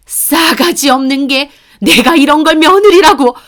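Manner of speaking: high, loud insulting sneer; mother-in-law insulting her daughter-in-law; contemptuous shout. Acted. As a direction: spit the insult out.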